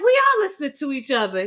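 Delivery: high, loud indignant insistence; heated protest. Retold or imitated. Wife protesting to the therapist, loud and indignant. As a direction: push back hard, voice jumping up.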